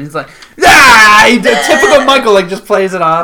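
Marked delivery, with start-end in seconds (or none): 0.6-1.2 loud mock yell; evasive noise. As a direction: blurt a loud noise to dodge the question. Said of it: "makes noises to get out of it".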